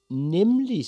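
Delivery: put-on high, loud, eager boy; cocky, excited yes; proud confirmation. A narrator's reading. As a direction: pipe it up triumphantly. Boy proudly confirms his wish.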